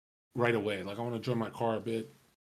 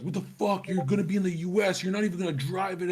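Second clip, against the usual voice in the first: higher, louder mock outrage; retold friend scolding a pricey car choice, higher and indignant; imitating mad friend; 6 semitones higher, 3 dB louder than usual. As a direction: play Eden fed up and exasperated. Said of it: "Eden was so mad".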